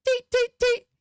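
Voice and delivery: three high, loud, short flute-like peeps; high staccato toots. Acted. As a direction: imitate piping notes, evenly spaced. Imitating player's timed musical notes.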